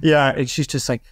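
ordinary delivery

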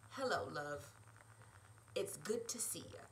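a lightly voiced smooth Irish charmer; lightly voiced smooth greeting, low and easy. Quinn greets Bridget up close, lightly voiced smooth and low. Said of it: "he says smoothly".

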